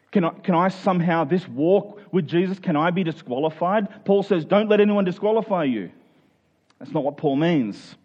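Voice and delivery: ordinary talk, nothing marked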